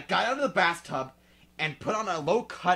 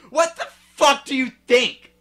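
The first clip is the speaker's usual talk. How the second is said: furious shouted snap; angry; 9 semitones higher, 13 dB louder than usual. Acted. Girl yelling at her worried friend, furious. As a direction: yell it back in outrage. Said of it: "I asked angrily".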